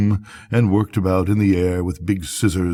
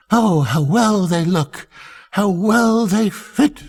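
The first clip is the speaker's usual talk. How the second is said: gushing admiration; 12 semitones higher, slower than usual. A narrator's reading. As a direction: fawn over the fit. All gushing at the suit.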